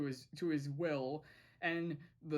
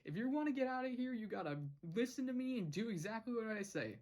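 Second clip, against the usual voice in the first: higher, fast commanding push; bossy; 6 semitones higher, faster than usual. Retold or imitated. Matt ordering Gus out of detention, fast bossy commands. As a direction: rattle off orders. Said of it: "Matt is like".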